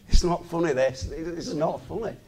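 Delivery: ordinary talk, nothing marked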